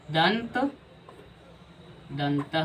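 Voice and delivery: ordinary talk, nothing marked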